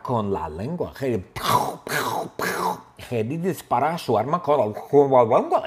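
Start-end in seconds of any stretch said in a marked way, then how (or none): 5.1-5.6 garbled words around an object; muffled mock mouth-full speech. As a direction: say it with tongue blocked. Mimics talking with full mouth.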